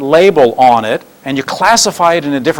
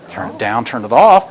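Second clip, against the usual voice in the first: ordinary talk, nothing marked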